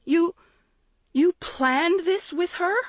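very high, slow, disbelieving question; aghast, pitch soaring; horrified. A narrator's reading. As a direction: cry it out in horrified betrayal. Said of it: "Maggie cried in horror".

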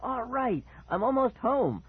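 high, bright burst of relief, voice jumping up; boy nearly home cries out with joy; joyful shriek. Acted. Cooper shrieks with relief, almost home. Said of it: "I shrieked with joy".

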